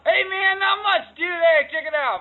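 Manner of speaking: high excited voice. Pretend. Bright high greeting.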